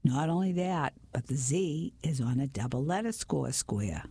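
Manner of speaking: usual speaking voice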